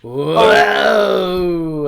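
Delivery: loud, high whoa; excited shout. Real, not acted. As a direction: cheer at the pick. Reacting to the pick.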